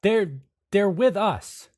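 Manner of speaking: high, loud urgent reassurance; calm her fast and firmly. Acted. Anderson calming Atticus with the knife, urgent reassurance.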